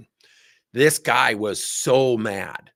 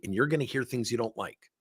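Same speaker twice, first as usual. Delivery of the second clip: ordinary talk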